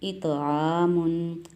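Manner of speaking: "इतआमुन" very slow, echoing t; slow qalqala. Shown as a demonstration. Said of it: "तो पे कलकला". ("इतआमुन" धीमे, कलकले के साथ)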